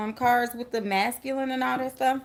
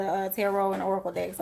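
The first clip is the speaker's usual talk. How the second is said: ordinary talk, nothing marked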